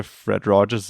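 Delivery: normal talking voice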